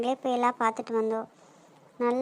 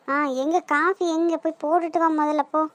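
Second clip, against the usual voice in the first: usual speaking voice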